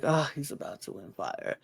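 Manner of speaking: resigned realization. Retold or imitated. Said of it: "I was like".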